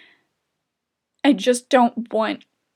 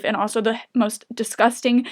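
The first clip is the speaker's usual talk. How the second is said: ordinary talk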